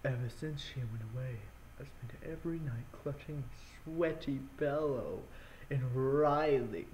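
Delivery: close-mic breathy reading. Real, not acted. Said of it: "Hopefully you guys enjoyed that breathing".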